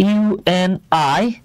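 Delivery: ইউএনআইসিইএফ said slowly, letter by letter, ending on a rise; spelled letter by letter. Shown as a demonstration. Teacher showing students the spelled-out form, letter by letter. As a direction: drag out ইউএনআইসিইএফ as separate letters, very slow. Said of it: "আমি যখন বলছি এইভাবে দেখেন".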